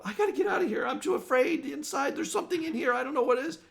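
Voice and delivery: high, loud panicked pleading; shrill with fear, desperate to flee; frightened woman. Acted. Terrified woman begging to get out, high and loud. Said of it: "a woman go".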